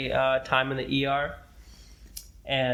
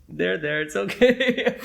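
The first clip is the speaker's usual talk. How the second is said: a hint of hammed-up soothing, rising much higher on okay; pat-on-the-back mock consolation, lightly played; mock comforting; 5 semitones higher, 5 dB louder, slower than usual. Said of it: "kind of thing".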